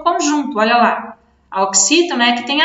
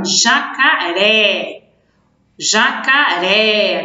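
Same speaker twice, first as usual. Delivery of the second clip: jacaré drawn out slowly, leaning on the final RÉ; teacher's slow demo of jacaré, long RÉ; stress on last syllable; 2 semitones lower, much slower than usual. Shown as a demonstration. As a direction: stretch jacaré syllable by syllable, RÉ strongest. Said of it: "o som mais forte".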